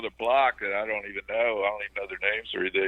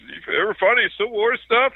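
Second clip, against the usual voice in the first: high, curious woman's voice; neighbor woman; 12 semitones higher, 6 dB louder than usual. Retold or imitated. Neighbor lady asking about Civil War finds, high and curious. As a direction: play the nosy neighbor lady, much higher. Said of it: "she goes".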